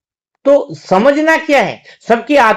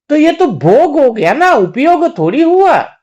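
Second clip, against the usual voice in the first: usual speaking voice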